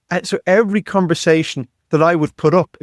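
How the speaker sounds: ordinary talk, nothing marked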